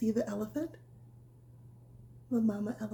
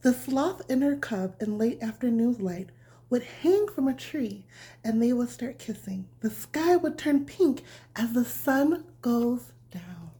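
bedtime story reading; 3 semitones higher, 7 dB louder, slower than usual. A narrator's reading. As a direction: read it gently like tucking a child in. Reads bedtime story to children.

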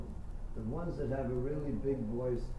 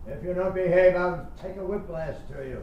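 loud, higher threatening father voice; abusive father; 7 semitones higher, 9 dB louder than usual. Retold or imitated. Teacher mocks a singer's sound as an abusive father. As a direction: bark it like a bullying dad. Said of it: "an abusive, uh, father".

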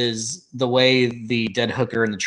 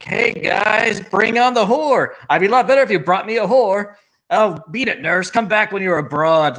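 lightly voiced randy old man, higher; lewd old man; 7 semitones higher, 4 dB louder than usual. Sick father to his sons, mock crude demanding. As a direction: pitch it up, cheerfully crude retelling.

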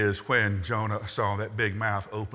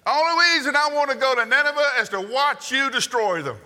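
much higher, loud put-on rant; Jonah ranting; 8 dB louder than usual. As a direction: blurt it out like a bitter prophet venting at God. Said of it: "that's what he says".

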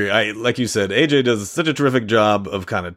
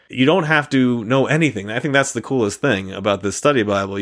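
ordinary delivery

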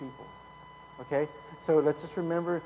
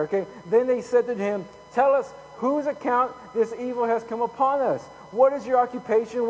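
raised, projected Bible storytelling; dramatic scripture reading; 8 semitones higher, 8 dB louder than usual. A narrator's reading. Preacher reading Jonah's storm story to the congregation, raised dramatic reading. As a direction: read the story out loud with force.

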